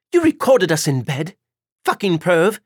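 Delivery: loud, high disgusted outburst; a woman finding out she was filmed in bed, furious; outraged. Acted. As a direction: spit it at him, appalled.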